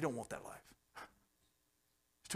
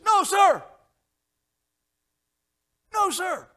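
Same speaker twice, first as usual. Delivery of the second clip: very high, loud strained recruit's cry; shouted no sir; 14 dB louder, slower than usual. Retold or imitated. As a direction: refuse to quit at full voice. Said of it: "And he says".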